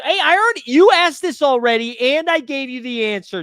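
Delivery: high, loud playful telling-off; mock scolding. Streamer teasing a viewer who asked twice, mock scolding. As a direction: scold him with pretend exasperation.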